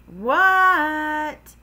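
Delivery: high, loud, stretched-out answer; yelling back. Acted. Froggy answers angry Dad, yelling back. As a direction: holler back down the hall.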